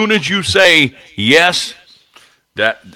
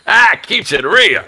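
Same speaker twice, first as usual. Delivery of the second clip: higher, slow "real"; mock catchphrase echo; 9 semitones higher, slower than usual. Real, not acted. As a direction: savour the phrase. Echoing catchphrase.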